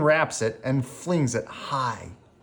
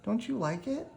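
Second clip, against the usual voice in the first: worried question; 10 semitones higher, 6 dB quieter than usual. Acted. Sophia fears hat disliked.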